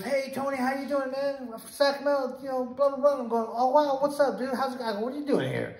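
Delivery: high-pitched, lively retold hello; excited greeting. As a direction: act out running into an old buddy. Acted greeting of an acquaintance met abroad.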